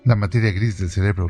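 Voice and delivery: plain reading voice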